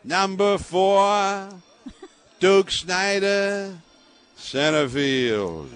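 slow, booming Brooklyn PA announcer; Brooklyn accent. Retold or imitated. Brooklyn announcer calls the batter to the crowd, in a slow, loud Brooklyn accent. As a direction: stretch each word out like an old Brooklyn ballpark announcer. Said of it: "he had this Brooklyn accent".

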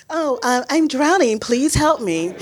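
high, hammed-up polite cry for help; mock plea. Swimmer mocking how she would ask for help, sarcastic. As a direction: pretend to drown with absurd politeness.

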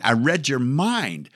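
mock mystic drawl. Shown as a demonstration. Host joking he read listeners' minds, slow mock mystic. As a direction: draw it out like a stage mind reader.

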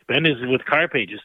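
ordinary talk, nothing marked

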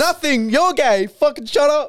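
very high, hammed-up lashing out; mock defensive outburst. Co-host playing husband caught crying, mock defensive outburst. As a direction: squeal insults in mock defensiveness.

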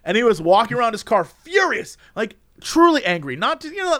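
ordinary talk, nothing marked

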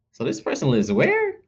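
high-pitched puzzled query; incredulous inner question. Retold or imitated. Acts out own puzzled silent thought.

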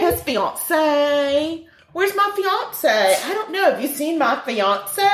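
high, hammed-up British on "fiance"; silly put-on British voice; mock British accent. Playing with British-style fiance.

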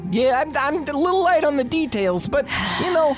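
a chirpy little sprite, self-assured; sprite character voice. Acted.

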